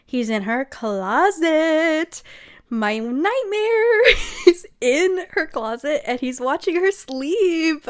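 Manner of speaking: high, slow drawn-out dismay; mock horror. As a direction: stretch it into a hammed-up wail. Hammed-up horror at plot twist.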